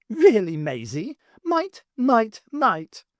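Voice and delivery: high, teasing, each might leaned on; mocking innuendo. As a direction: tease with a knowing repeat.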